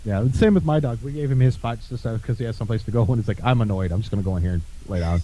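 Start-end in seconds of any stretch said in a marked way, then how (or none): 3.4-5.2 grumpy dog voice. Retold or imitated. Owner voicing his sulking dog.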